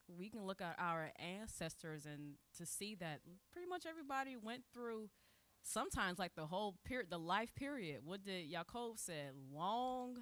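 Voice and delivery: ordinary talk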